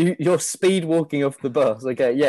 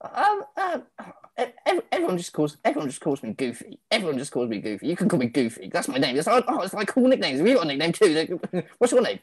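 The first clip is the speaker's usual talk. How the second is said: nervous chihuahua Goofy; 5 semitones higher, faster than usual. Acted. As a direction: stammer shyly, then babble on.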